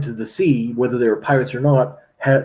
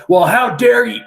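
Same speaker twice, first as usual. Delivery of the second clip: indignant outburst; 8 semitones higher, 6 dB louder than usual. Retold or imitated. Pirate keeps arguing.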